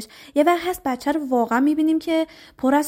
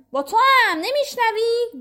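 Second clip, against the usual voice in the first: high, drawn-out mock yell; acted yell; 10 semitones higher, slower than usual. Parent calling someone who isn't paying attention, mock yelling. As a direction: call out impatiently at someone ignoring you, pitch up.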